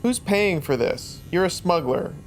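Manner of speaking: ordinary talk, nothing marked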